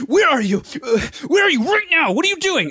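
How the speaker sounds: high, loud frantic questions; older brother calling in a panic, frantic. Retold or imitated. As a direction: imitate the scared brother on the phone. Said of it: "my older brother, and he's just".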